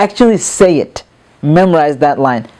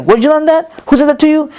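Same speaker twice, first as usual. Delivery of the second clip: higher-pitched, mock-indignant questioning; upset scolding; 8 semitones higher than usual. Parent to child who said a curse word, upset. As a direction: act out an upset parent demanding answers. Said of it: "You get really upset, like".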